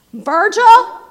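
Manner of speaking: loud, high divine summons; God calling out. Retold or imitated. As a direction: call the name out as a mighty summons. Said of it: "that God said".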